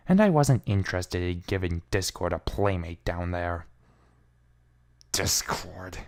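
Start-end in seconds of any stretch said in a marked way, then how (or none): 5.1-6.0 quiet seethe. A narrator's reading. Twilight remembering her enemy, quiet seething. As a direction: spit the name out under your breath. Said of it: "I seethed".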